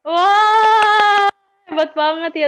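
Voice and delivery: long, loud, high "wah" of admiration; kagum gembira. Real, not acted. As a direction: cheer the student with a drawn-out wow. Murid berhasil menjawab, guru kagum. (seruan kagum yang panjang)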